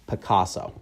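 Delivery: ordinary delivery, nothing marked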